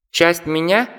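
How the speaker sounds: high squeaky astonished bat; surprised squeaky bat. Acted. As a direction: squeak it out in disbelief. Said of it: "изумился летучая мышь".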